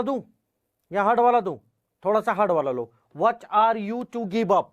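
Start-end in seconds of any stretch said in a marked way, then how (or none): 3.2-4.7 slow, evenly stretched dictation of the whole question. Shown as a demonstration. Teacher dictating a question to the online class, slowly. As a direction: read it out slowly so students can write it down. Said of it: "दोबारा इसी type का एक और question".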